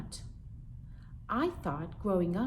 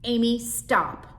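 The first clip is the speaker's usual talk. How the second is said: slow, louder warning; mother scolding; 5 semitones higher, 8 dB louder, slower than usual. Acted. Mother stopping her daughter's teasing, slow and firm. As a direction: warn her daughter firmly. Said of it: "My mother".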